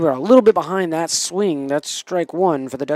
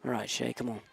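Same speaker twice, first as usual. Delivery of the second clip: usual speaking voice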